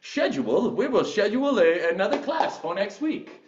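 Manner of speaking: high, hammed-up British "Schedule" with a sh; exaggerated British instructor; British accent. Shown as a demonstration. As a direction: relish the word in mock-British. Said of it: "where I'm like".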